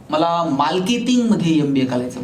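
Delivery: imitating a student who says every "र" as "ल"; mock speech impediment, "मार्केटिंगमध्ये" with L for R; lisping R. Student answers his teacher, imitated with "करायचं" said with L for R. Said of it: "त्याला र बोलता यायचं नाही".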